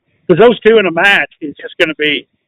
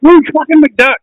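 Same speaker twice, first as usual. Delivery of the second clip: high-pitched mock Scottish accent; pitched-up cartoon Scottish burr; Scrooge McDuck; 10 semitones higher, 4 dB louder than usual. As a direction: exclaim it in a comic Scottish accent. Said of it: "I can do Scrooge McDuck".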